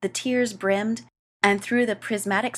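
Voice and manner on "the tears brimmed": ordinary delivery, nothing marked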